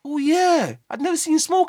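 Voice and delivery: high mock voice of surprised fans; imitating people. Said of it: "they always say".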